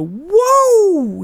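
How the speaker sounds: high, drawn-out whoop; re-enacted yell. Dad in the car for no reason, re-enacted yell. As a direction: let out a sudden long "whoa" high above normal speech. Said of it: "Just yell it out".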